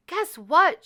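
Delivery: high, loud excited call; bursting to share news; excited cry. A narrator's reading. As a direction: Cinderella telling the mice. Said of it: "she cried".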